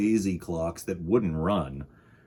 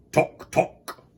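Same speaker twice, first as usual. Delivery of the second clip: a louder tock for the big clocks; 5 semitones higher, 4 dB louder than usual. Acted. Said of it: "said the big ones".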